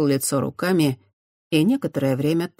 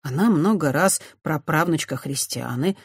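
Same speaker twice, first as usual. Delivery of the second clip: usual speaking voice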